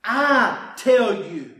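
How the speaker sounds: slow, loud, weighty pronouncement; a slow, loud declaration of divine authority; Jesus with all authority. Acted. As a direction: lay each word down with authority. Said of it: "he's saying it with all authority".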